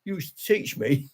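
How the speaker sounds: put-on a playful take on a pupil catching their old teacher out; mock startled ex-pupil. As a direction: play a former student suddenly recognising you. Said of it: "People will come back and say".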